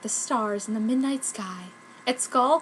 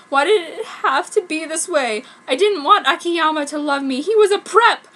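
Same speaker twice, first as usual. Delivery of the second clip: higher, louder hammed-up wail; melodramatic despair; 6 semitones higher, 8 dB louder than usual. Goth girl in the stall, hammed-up despair. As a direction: cry out to the heavens.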